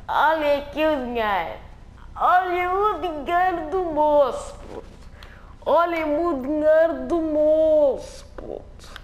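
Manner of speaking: building excited bragging; excited boast. Acted. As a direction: show off the cash.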